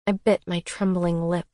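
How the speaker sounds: ordinary delivery, nothing marked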